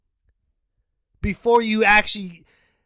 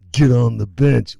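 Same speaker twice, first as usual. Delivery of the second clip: low, loud bench order; mock stern coach, low and forceful; 8 semitones lower, 5 dB louder than usual. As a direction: bark it as a fed-up coach. Acted coach repeats the order.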